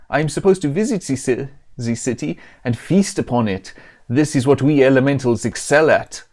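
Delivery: badly faked Italian accent. Pizza elemental explains its plan to feast, in mock Italian accent. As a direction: put on a vaguely Italian-Mexican accent. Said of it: "stop using this accent".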